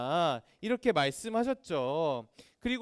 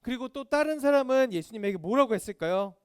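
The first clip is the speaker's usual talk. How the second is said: usual speaking voice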